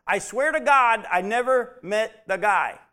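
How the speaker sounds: loud, high, insistent oath; Peter, cornered, shouting his denial; Peter's desperate oath. Retold or imitated. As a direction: swear it loudly like a cornered man denying. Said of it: "That's what he actually said".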